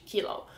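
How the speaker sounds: "kilo" as SHEE-lo; Swedish pronunciation. Shown as a demonstration. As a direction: say kilo with a soft sh for the k. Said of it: "in Swedish we pronounce it kilo".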